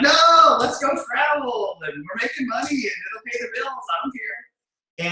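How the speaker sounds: pleading, then fading. Retold or imitated. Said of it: "I'm like".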